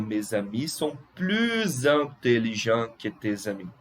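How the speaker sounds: "plus intelligents" linked as "pluz intelligents"; liaison with Z. Shown as a demonstration. Teacher repeating French example, Z liaison in "plus intelligents". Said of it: "eu pronuncio S com som de Z".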